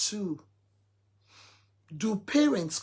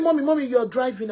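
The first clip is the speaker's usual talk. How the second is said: higher, louder, quick-fire warning; urgently calling out to mum; retold urgent warning; 4 semitones higher, 6 dB louder, faster than usual. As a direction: retell a child's urgent alert to mum. Son warns mother who is driving.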